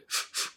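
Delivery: "ふ、ふ" as puffs of f with no vowel; just let air out for "ふ"; air-only f. Shown as a demonstration. Teacher demonstrating final f, voiceless puffed "ふ". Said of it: "空気抜くだけです".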